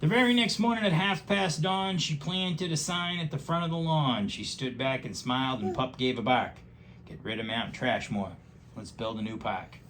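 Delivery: lively rhyming storybook read; reading to a child. Acted. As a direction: read it aloud with bouncy rhythm. Reads picture book aloud.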